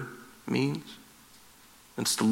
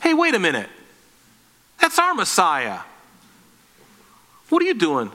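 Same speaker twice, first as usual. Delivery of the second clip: lightly acted indignant objection, pitch falling away; mock jealous protest; 2 semitones higher than usual. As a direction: play a jealous onlooker protesting, briefly.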